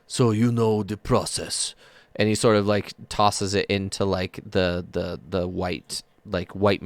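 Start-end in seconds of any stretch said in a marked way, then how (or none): 0.0-1.7 slow, welcoming big ogre voice; gentle ogre smith. Acted. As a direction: greet them warmly, slowly, like a giant.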